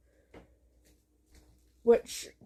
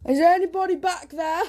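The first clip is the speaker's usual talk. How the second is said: higher, louder call into the back; raised, questioning call; 6 semitones higher, 5 dB louder, faster than usual. Real, not acted. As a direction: call out to whoever might be there. Calls out to unseen presence.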